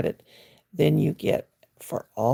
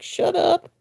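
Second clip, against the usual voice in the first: mock snap; 11 semitones higher than usual. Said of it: "I'm like".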